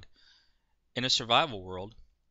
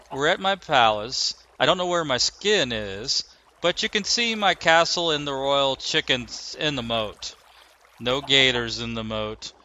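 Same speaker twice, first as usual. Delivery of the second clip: loud showman host; 4 semitones higher, 12 dB louder than usual. Acted. Loud showman tour of castle.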